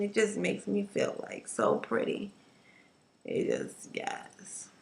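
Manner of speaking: unvoiced dreamy whisper; swooning whisper. Real, not acted. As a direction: breathe it out lovingly. Swooning over hair colour.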